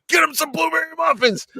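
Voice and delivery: high, urgent comic yell; frantic mock shout. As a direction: shout a desperate order, hammed-up. Frantic order to diner man.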